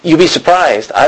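ordinary talk, nothing marked

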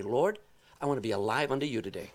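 hushed, quick little prayer to the Lord; quiet prayer. Pretend. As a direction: pray it softly and simply. Voiced morning prayer.

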